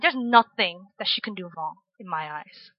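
low, soft stern dad; stern father. Retold or imitated. Dad laying down his view of his daughter, quietly stern. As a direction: lightly imitate a stern, protective father. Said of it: "my dad's very stern".

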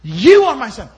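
YOU hit hard, loud and high, then falling; God speaking directly. Acted. God speaks directly to Jesus, loud emphatic YOU. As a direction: punch YOU as direct address. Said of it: "He says".

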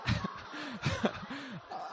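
laughing. Real, not acted. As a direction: break into real laughter. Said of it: "I'm sorry, Sister Tiffany".

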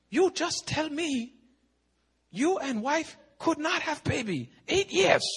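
very high, slow imitated Chinese accent; mock Chinese-accented cross-examination; Chinese-accented pastor. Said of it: "I look at the Chinese pastor".

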